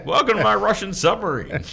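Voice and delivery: 3 ham up a villainous host welcoming you aboard; 4 playful put-on captain's welcome; 1 mock submarine captain. Mock Russian captain greeting.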